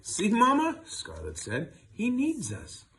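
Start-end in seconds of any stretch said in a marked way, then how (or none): 0.0-0.8 put-on high, loud little girl voice; eager child. Scarlet urging her mother to keep the puppy, high and eager. 1.9-2.9 put-on eager child. Scarlet urging her mother to keep the puppy, high and eager.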